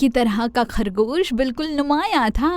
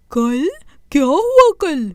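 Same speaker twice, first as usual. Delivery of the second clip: louder, higher, puzzled question; puzzled Chanda Mama; 4 semitones higher, 4 dB louder, slower than usual. Acted. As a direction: ask back, surprised. Chanda Mama asks back.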